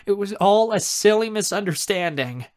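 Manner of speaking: relieved aunt. Acted. Aunt shares that charges vanish, relieved and excited. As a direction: gush the good news.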